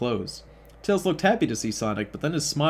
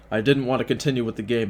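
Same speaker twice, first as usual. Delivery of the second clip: ordinary talk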